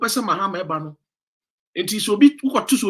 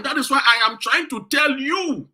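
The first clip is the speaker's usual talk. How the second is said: shouting; 7 semitones higher, 3 dB louder than usual. Real, not acted. Urging listeners to reason.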